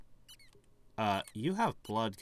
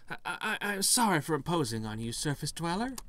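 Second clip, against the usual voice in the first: higher-pitched, hesitant ghost pleading; higher voice, tripping over a courteous plea; apologetic ghost; 10 semitones higher than usual. Acted. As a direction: stammer out a polite request as a timid ghost. Voices ghost's request in the game.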